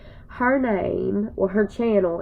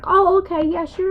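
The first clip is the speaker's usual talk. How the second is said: mock friend in a much higher voice; 11 semitones higher, 4 dB louder than usual. Retelling her friend's reply, much higher. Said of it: "she's like".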